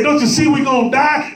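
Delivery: high-pitched, urgent plea; alarmed disciple. Acted. Disciples wake Christ in the storm, alarmed. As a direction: cry out like a panicked disciple.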